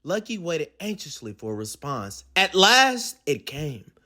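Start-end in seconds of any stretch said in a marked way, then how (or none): none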